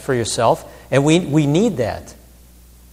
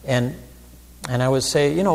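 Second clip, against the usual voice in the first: ordinary talk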